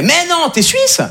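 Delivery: high, incredulous question; surprised Frenchman. Retold or imitated. A man in Calais doubting he is Swiss, high surprised voice. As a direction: pitch it up in disbelief. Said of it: "il me fait".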